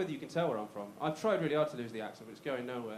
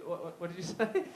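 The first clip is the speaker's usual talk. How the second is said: normal talking voice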